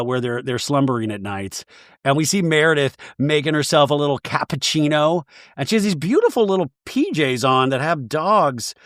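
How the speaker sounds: ordinary talk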